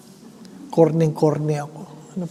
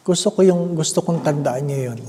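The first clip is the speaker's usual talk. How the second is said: ordinary talk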